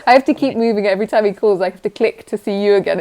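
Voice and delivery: ordinary delivery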